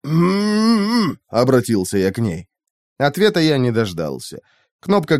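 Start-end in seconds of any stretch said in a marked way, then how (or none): 0.0-1.2 muffled moan. A narrator's reading. Gagged hero tries to speak.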